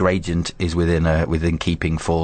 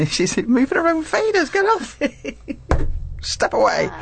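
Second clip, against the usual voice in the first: playful shoo; slower than usual. Real, not acted. Shooing co-host off her faders.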